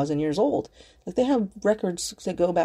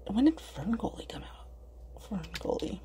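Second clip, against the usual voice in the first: whisper to self; 2 semitones higher, 10 dB quieter than usual. Real, not acted. Vlogger searching a date, whispering to herself. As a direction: think aloud in a whisper.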